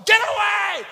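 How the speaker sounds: high, full-force yell; enraged scream. Retold or imitated. Car rental man throwing luggage onto the street, enraged scream. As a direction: scream them off in a rage. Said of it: "og han skrek".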